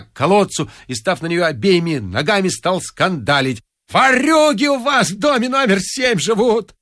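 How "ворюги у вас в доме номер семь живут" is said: high, shrill janitor's yowl, much higher; a drunken janitor bawling at the neighbours; howling janitor. Acted. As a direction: scream it across the whole yard. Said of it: "вопил дворник".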